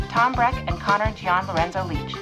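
normal talking voice

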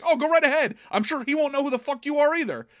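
high-pitched, quick taunt; mocking retort. Picard daring the ghost to tell the mayor, mocking.

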